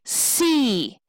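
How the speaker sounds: "C" drawn out long, falling; stressed SEA. Shown as a demonstration. Teacher offering the other choice, long stressed "C". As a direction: stretch "C" into a long stressed syllable. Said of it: "or do we say".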